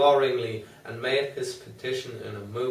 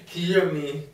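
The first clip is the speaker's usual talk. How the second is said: "hear me" cried higher and louder; loud plea; 6 semitones higher, 5 dB louder than usual. A narrator's reading. Patient begging the doctor, higher louder cry. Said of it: "poured forth a torrent of entreaty".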